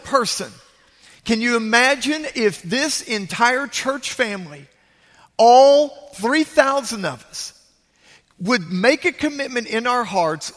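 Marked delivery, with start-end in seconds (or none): 5.4-7.5 emphatic. Real, not acted. Preacher stressing church size.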